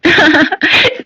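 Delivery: high jeering giggle; mocking laugh. Rich girls laughing at poor Radha, high jeering giggle. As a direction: laugh at her to hurt.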